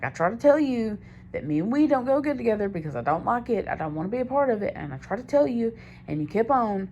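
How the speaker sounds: retold argument, high and insistent; exasperated protest. Girlfriend arguing back after a bad high, exasperated. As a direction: plead your case in rising frustration.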